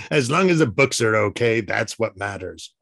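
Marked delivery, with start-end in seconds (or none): 0.0-1.6 laughing through the words. Real, not acted. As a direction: chuckle while saying it. Joking about the books, laughing.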